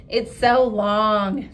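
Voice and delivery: slow, loud drawn-out taunt; teasing classmate. Acted. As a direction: stretch it out to mock.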